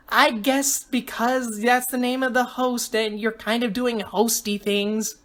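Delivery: lightly voiced girl, matter-of-fact; quirky girl contestant, guessing. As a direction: reason it out in a light girl's voice, slowing. Voices Amanda.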